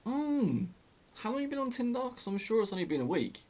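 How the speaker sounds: high, knowing, sarcastic questioning; teasing sarcasm. Retold or imitated. Teasing a friend about her week-old boyfriend, high and sarcastic. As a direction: lift it way up and tease her.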